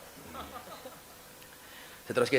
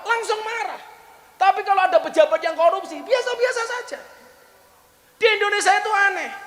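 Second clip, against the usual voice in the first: fiery preaching; 6 semitones higher than usual. Acted. Preacher lecturing the congregation on hypocrisy, fiery preaching.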